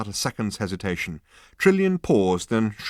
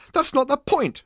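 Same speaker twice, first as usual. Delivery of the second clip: much higher, louder protest; 7 dB louder than usual. A narrator's reading. Arthur protesting to Zaphod, higher and louder. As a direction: snap back in protest. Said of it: "Arthur protested".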